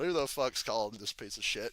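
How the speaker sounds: understated quote, dropped in pitch and volume; subdued retelling. Retold or imitated. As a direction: repeat the line low, without the shout. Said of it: "Punk just says out loud".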